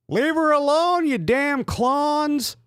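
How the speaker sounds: very high, slow mock-heroic falsetto; Paul voice. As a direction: drag it out like a cheesy rescuer.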